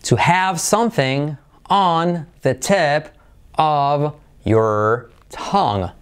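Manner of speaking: each word stretched out slowly; slow, careful model reading; slow model phrase. Shown as a demonstration. Teacher modelling the idiom slowly for Spanish learners. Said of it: "tener algo en la punta de la lengua".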